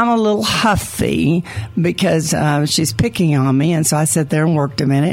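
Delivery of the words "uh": normal talking voice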